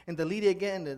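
usual speaking voice

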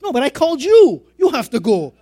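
loud, high-pitched acted protest; insistent retort. Acted argument on phone with pastor.

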